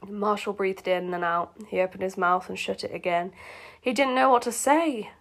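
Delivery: ordinary delivery, nothing marked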